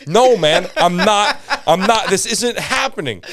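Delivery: high, loud protest, half laughing; desperate pleading. Retold or imitated. Bombing comic pleading with the host, loud and high. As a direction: beg the crowd not to give up on you.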